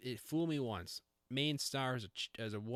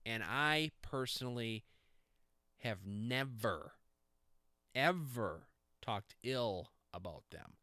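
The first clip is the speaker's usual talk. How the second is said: slow dramatic buildup; slower than usual. Acted.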